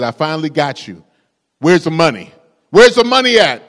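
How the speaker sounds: demanding, rising to a loud shout; angry ranger. Acted. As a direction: corner him and yell for the money. Ranger confronts the bandit.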